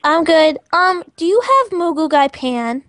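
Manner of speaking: ordinary delivery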